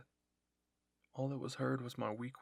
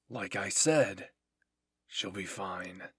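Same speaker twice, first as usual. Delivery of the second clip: low, slow, firm soldier's voice; insistent dismissal; 5 semitones lower, 6 dB louder, much slower than usual. A narrator's reading. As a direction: spell it out slowly and low to end the argument. Reiner insists to worried comrade.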